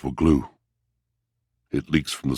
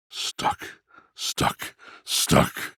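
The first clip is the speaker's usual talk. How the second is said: breathy whisper, each word softer than the last; whispered repetition; 3 semitones lower, 5 dB quieter, much slower than usual. Acted. Old soul brooding on being stuck, whispering to himself. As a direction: whisper it to yourself, sinking.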